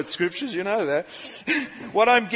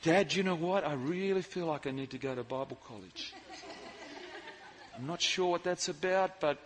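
quiet, hesitant confession to Dad; soft sincere confession; 3 semitones lower, 11 dB quieter than usual. Retold or imitated. [name] quietly admitting to his dad he wants Bible college. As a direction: admit it gently with a little laugh.

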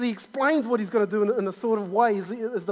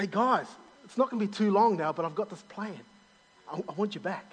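tender God voice; 7 dB quieter than usual. Acted. God voiced reassuring people.

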